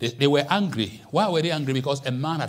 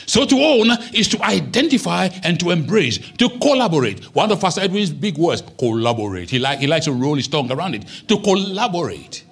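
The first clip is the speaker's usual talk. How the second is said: loud, emphatic sermon delivery; forceful preaching; 4 semitones higher, 6 dB louder than usual. Acted. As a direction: preach it out to the congregation. Preaching with raised voice.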